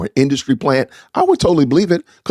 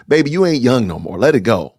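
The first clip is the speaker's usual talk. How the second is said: ordinary delivery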